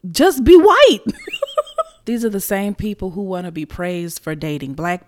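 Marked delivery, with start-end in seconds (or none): 0.1-1.0 louder and higher, exasperated; loud exasperated punchline; emphatic. Real, not acted. 1.0-1.8 a high squeal of laughter; short high squealing laugh. Real, not acted. Laugh after own punchline.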